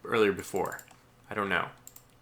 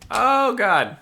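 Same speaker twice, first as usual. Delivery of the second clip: much higher and louder, thrilled cry; real thrill as the battle swings; excited outburst; 13 semitones higher, 9 dB louder than usual. Real, not acted. Player reacting to a wild naval battle, excited outburst.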